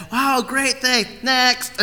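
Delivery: quick mock thanks, already onto the next gift; dismissive kid attitude. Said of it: "that's their attitude".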